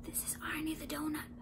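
hushed, urgent whisper; frantic whisper. A narrator's reading. Donut secretly names himself to baker, urgent whisper. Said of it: "Arnie frantically whispered".

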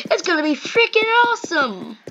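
high-pitched cartoon exclamation; excited cartoon character.

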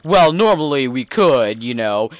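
streetwise gangster's sidekick voice; mob henchman. Acted. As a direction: talk like the boss's loyal goon.

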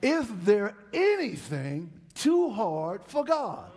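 slow, loud and high shouted question; preaching shout. Acted. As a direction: hammer the question at the church. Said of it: "I'm gonna ask y'all a question again".

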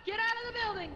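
high-pitched panicked warning shout; urgent yell. Real, not acted. Player warning teammates out of a building, urgent high yell. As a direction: yell it high and urgent, pushing them to run.

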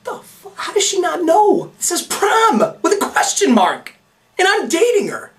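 high, disbelieving squeak; baffled inner voice. Retold or imitated. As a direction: imitate his past self in shock. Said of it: "I'm like".

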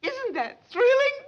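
high, slow, gushing; thrilled. Acted. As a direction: swoon over the sight. Socialite spots princess.

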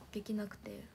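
disappointed whisper. Real, not acted. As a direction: let it fade into a whisper. Missing the live broadcast.

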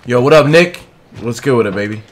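usual speaking voice

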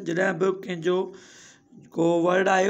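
ordinary delivery

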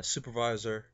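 normal talking voice

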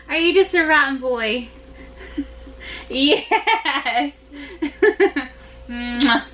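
normal talking voice

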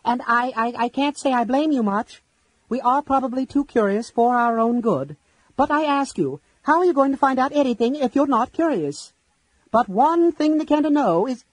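high, loud, fast nervous kender; shrill kender voice. Acted. Tas addressing the lords while edging toward the orb, shrill. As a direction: squeak it out, scared but bold. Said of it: "his voice shrill".

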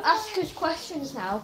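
ordinary talk, nothing marked

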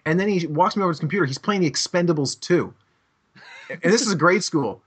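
usual speaking voice

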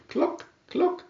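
high, soft hen cluck. A narrator's reading. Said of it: "said the red hen".

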